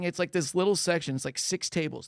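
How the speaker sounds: normal talking voice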